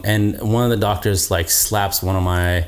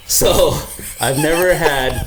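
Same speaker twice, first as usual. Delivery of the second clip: talking through laughter; laughing; 6 semitones higher than usual. Real, not acted. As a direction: laugh while telling it. Laughing about the urinal.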